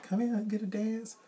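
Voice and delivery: a hint of a high coaxing voice; lightly mimicked dancer. Dancer luring the husband, lightly voiced high coaxing. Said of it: "He like".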